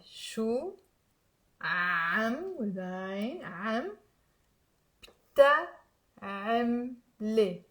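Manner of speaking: slow, careful syllables with long pauses; slow demo. Shown as a demonstration. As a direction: stretch each sound for learners. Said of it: "I will say it slowly".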